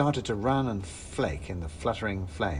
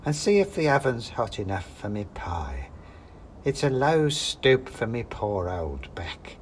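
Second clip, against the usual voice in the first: put-on creaky old crone voice, slowing down; a hunched old woman grumbling about her back. Old woman asks the guest to check the oven, in a creaky crone voice. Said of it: "said the old woman".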